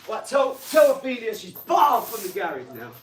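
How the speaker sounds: high, loud telling-off; scolding shout. Real, not acted. Streamer banning Ophelia from the garage over her mess, scolding shout. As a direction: shout the ban across the room.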